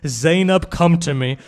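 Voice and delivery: grieving reciter crying out the tragedy; impassioned preaching. Acted. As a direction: let the lament climb to a full-voiced cry. Impassioned lament, building to a cry.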